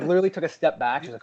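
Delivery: ordinary delivery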